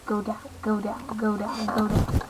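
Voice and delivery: soft, low begging; hushed desperate go down; quiet pleading. Real, not acted. Pleading as he rises.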